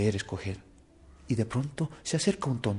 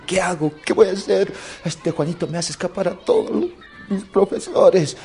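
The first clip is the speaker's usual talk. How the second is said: mildly hammed-up loud, high fretting; worried director; 7 semitones higher, 7 dB louder than usual. Director losing every teacher to Juanito, acted high worry. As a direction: lament at the end of your tether. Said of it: "Preocupado, preocupado".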